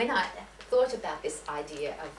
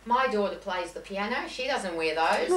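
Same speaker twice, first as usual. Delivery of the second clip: mock Australian shopkeeper, louder and livelier; broad Australian accent; 3 semitones higher, 6 dB louder than usual. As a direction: put on a strong Australian accent for a laugh. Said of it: "in his best Australian accent".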